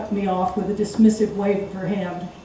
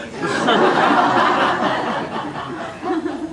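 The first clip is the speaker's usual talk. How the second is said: long breathy laugh; 6 semitones higher than usual. Acted. Narrator laughs at his own insult.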